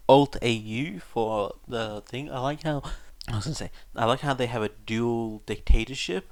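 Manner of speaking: plain reading voice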